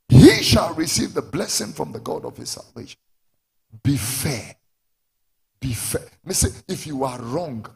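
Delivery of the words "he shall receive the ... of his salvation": loud promise, launched on a shouted HE; forceful preaching. Real, not acted. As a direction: proclaim it loudly to the congregation.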